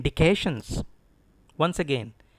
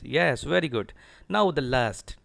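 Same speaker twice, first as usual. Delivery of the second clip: usual speaking voice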